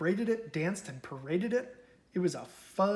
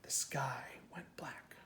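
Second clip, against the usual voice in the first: hushed, slow whisper; 3 semitones lower, 12 dB quieter, slower than usual. A narrator's reading. Narrator as the eagle swoops, whispered. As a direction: breathe it out, ominous. Ominous moment as eagle strikes.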